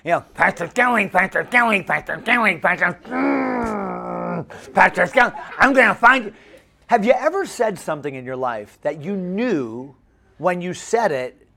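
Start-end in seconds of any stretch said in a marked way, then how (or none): 0.3-6.3 put-on pestering goofy kid's voice, with a long droning mm; Bobby. Camper hounding his pastor, goofy relentless nagging. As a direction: nag on and on in a put-on boy's voice. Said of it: "he's, like, saying".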